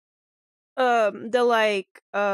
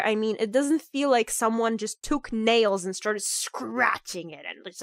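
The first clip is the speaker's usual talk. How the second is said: usual speaking voice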